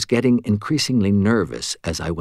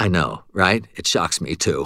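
plain reading voice

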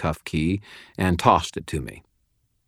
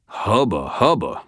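ordinary delivery, nothing marked

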